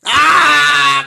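loud, high drawn-out shriek; sudden yell. Acted. Caller bursting back in on the host, long loud yell.

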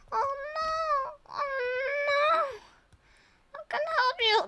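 lightly voiced squeaky hero; high cartoon voice. Playing a rescuer hero.

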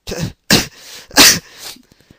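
bursts out laughing loudly; a big loud laugh. Real, not acted. Big laugh at own pun.

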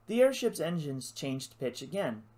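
ordinary delivery, nothing marked

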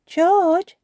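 put-on high, loud child voice; Peppa calling. A narrator's reading. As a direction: call your little brother. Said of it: "says Peppa".

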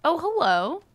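ordinary talk, nothing marked

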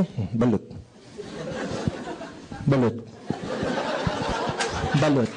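low, soft, shy "balot"; timid vendor call. Pretend. Speaker as a scared vendor barely daring to call out "balot". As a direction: call as if afraid of being beaten. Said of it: "Pag ako gulpihin ito".

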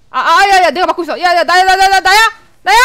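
loud, high startled repeats; alarmed yelling. Real, not acted. Player finding themselves targeted, alarmed yelling. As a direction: cry out that you are the target. Realises they are being targeted.

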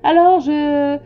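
loud, slow, dragged-out stammer; pompous king losing the thread; spluttering vexed king. A narrator's reading. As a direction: flounder loudly through a decree, stretching words. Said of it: "Il bredouillait un peu et paraissait vexé".

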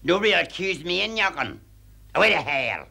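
loud, defiant retort; shouting back. Acted. Cleaner shouting down her snobbish employer. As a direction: tell her where to go.

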